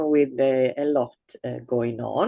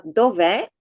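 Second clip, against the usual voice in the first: dov'è with a rising end; rising question tune; 7 semitones higher, 4 dB louder than usual. Shown as a demonstration. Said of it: "something singular".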